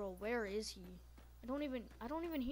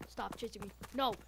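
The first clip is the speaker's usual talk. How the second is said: normal talking voice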